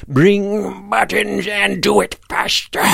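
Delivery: put-on high, slow, ominous old-creature voice. A narrator's reading. Hidden voice orders children to bring buttons, ominous and high. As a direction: menace them in a drawn-out high voice. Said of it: "He sounded very ominous".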